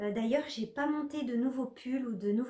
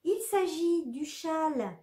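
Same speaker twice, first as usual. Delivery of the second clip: ordinary talk, nothing marked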